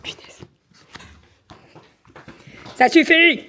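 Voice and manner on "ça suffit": sharp, loud, high scolding; scolding the dog. Real, not acted. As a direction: stop the dog with a loud rebuke. Gronde le chien agité. (gronde le chien fort et haut)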